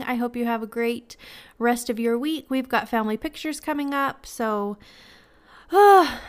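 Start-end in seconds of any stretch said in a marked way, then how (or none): 5.7-6.2 loud, high exhaled "ah"; stressed sigh. Real, not acted. Vlogger facing family pictures coming up, stressed sigh. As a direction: let out a big stressed sigh. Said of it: "that's stressful".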